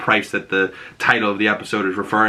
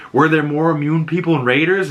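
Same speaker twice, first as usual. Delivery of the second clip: higher, pressing question; acted interrogation; 6 semitones higher than usual. Ellie confronts Joel about the hospital, pressing. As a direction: press him for an answer.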